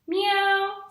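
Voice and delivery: high, loud sing-song meow; playful kitten voice for the children; cat meowing. Acted. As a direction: mew like a cute storybook kitty. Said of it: "said his cat".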